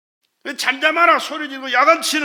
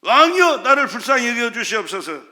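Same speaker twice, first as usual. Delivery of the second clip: crying out; 5 dB louder than usual. Retold or imitated. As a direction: imitate a beggar hailing a king. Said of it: "얼마나 소리를 질렀는지 몰라요".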